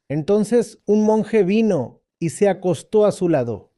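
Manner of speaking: ordinary delivery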